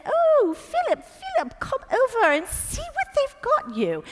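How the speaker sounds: a high, fond Queen calling across the room. Retold or imitated. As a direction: call sweetly to your husband like the Queen. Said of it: "and she said".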